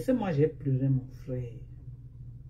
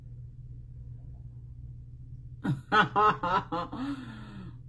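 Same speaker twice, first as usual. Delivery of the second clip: long laugh rising out of breath; amused laugh; 3 semitones higher, 6 dB quieter than usual. Real, not acted. Streamer laughing at being called grandmother, amused cackle. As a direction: burst into a drawn-out laugh.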